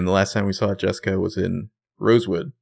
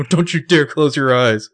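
high, louder mock plea; mock pleading; 7 semitones higher, 5 dB louder than usual. Host repeating Arya's plea to Ezra, high mock plea. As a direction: beg him to stay awake. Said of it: "quoting Arya".

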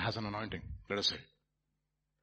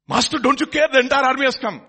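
loud, high-pitched cry of alarm; acted panic; 14 semitones higher, 15 dB louder than usual. Servant alerts his master to the army, acted panic.

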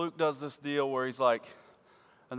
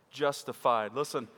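normal talking voice

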